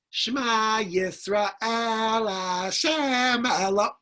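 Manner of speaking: slow, drawn-out mock prayer chant; reciting prayer. Yaakov praying while his son cries, slow mock chant. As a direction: intone it like a man lost in prayer. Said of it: "And he goes".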